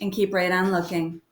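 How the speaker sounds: usual speaking voice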